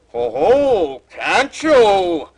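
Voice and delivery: loud booming ogre laugh; ogre bellow. A narrator's reading. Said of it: "the ogre bellowed".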